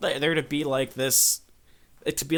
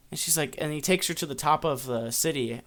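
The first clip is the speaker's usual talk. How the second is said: ordinary delivery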